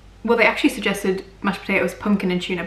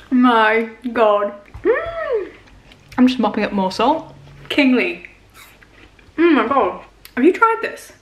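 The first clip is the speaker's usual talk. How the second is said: talking between bites of fruit; chewing apple. Real, not acted. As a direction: mouth partly full while speaking. Seen 1: Bitten apple at -1, chews with closed lips, eats again at 6.0.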